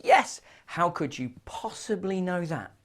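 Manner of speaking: high-pitched, astonished question; acted amazement. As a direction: play amazed at being found out. Joke character amazed at being guessed.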